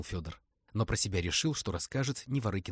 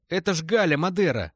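high, loud protest; 9 semitones higher, 8 dB louder than usual. Acted. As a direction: object loudly, defending her. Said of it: "запротестовал Плюгавый". (возмущённо протестуя)

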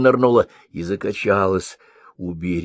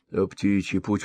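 plain reading voice

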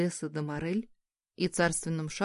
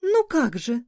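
high, louder playful protest; sultry woman; 7 semitones higher, 6 dB louder than usual. A narrator's reading. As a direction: toss it off like a coquette.

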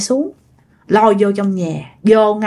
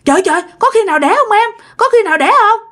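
high, quick faked panic; mock alarm; 9 semitones higher, faster than usual. Father pretending to panic to his wife, quick and high. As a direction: pretend to fuss over a wife in labor. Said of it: "ba mới giả vờ".

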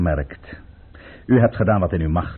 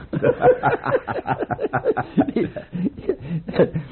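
a long, amused courtier's laugh; 8 semitones higher than usual. Acted. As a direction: laugh it off knowingly.

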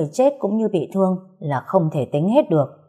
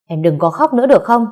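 sharp, louder and higher outburst; irritated shout; 5 semitones higher, 6 dB louder than usual. A narrator's reading. Husband losing patience at his crying wife, shouting. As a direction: snap at her to stop crying, loudly. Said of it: "bực mình quát".

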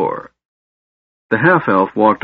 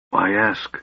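tired and quiet; weary; 4 dB quieter than usual. Acted. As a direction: let the question sag with fatigue. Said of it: "Raistlin said wearily".

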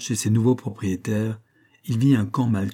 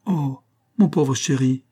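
high, slow, pitying cry; graceful despair; 4 semitones higher, 3 dB louder than usual. A narrator's reading. Mercedes hugs Buck tearfully, gracefully despairing. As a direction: coo in pretty distress. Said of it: "s'écria-t-elle avec un gracieux désespoir".

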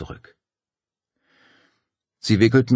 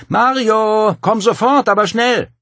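much higher, louder urgent shout; calling into the house; 9 dB louder, slower than usual. A narrator's reading. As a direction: yell for her husband upstairs. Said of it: "rief ins Haus hinein".

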